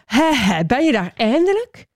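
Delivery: impatient high call from a well. A narrator's reading. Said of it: "En riep naar boven". (ongeduldig omhoog roepend)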